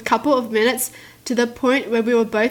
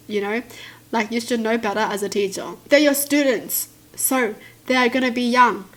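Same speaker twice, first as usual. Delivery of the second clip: ordinary delivery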